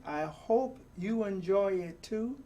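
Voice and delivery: usual speaking voice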